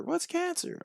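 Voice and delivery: retold kid's question, high and soft; innocent questioning. Kid asking mom about an unknown illness, puzzled. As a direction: ask it small and high, not knowing.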